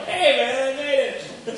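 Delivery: high, loud boasting yell. Retold or imitated. The eagle bragging to his pals, yelling high and loud. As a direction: crow it to your buddies. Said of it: "He's yelling to all of his pals".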